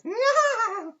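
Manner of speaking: high, loud mock cackle; sudden piercing imitation of a creepy giggle; imitated cackle. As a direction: cackle like a creepy little man. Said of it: "just cackling at me, just giggling".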